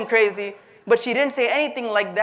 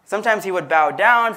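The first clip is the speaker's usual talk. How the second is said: ordinary delivery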